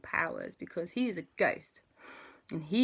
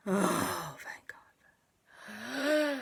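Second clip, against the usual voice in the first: 1 relieved whisper; 5 semitones higher, much slower than usual. Acted. Relieved after monster gone.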